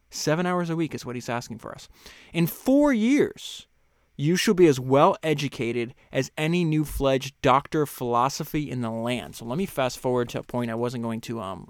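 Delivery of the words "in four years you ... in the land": raised, measured reading voice; reading a quote aloud. Acted. Host reading a book passage to listeners, measured. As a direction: read the author's words with weight.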